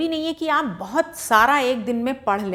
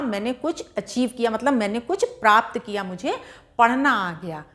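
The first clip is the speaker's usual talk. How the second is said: ordinary talk, nothing marked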